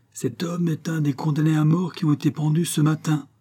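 high-pitched cry of horror; shocked exclamation. A narrator's reading. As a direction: exclaim the horrified realisation. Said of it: "s'écria le docteur".

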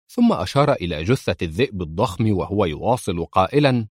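ordinary delivery

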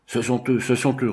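a narrator's restrained roar of recognition; understated roar. A narrator's reading. Doctor spots the enemy at the porthole, mildly roared. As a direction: give the cry a contained roar, without real volume. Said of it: "rugit-il".

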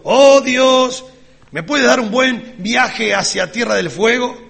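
calls out high and loud to God, hamming it up; a fake prayer said loudly so Satan overhears; loud mock prayer. Preacher fakes a loud prayer for Satan's ears, hammed-up and loud. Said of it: "Y fuerte digo".

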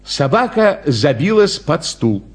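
ordinary delivery

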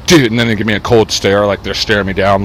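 normal talking voice

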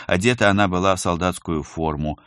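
plain reading voice

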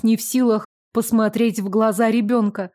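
ordinary delivery

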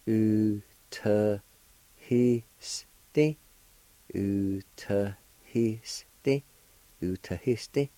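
Utahiste stretched out very slowly; teacher sounding out slowly; slow careful pronunciation. Shown as a demonstration. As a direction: model the word syllable by syllable. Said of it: "is pronounced like this".

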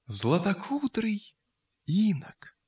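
ordinary delivery

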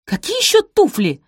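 high, loud, exasperated question; irritated. A narrator's reading. Daughter has no patience with mother's shoe talk, irritated. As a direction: toss it out in annoyance. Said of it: "раздражённо бросила Розамунд".

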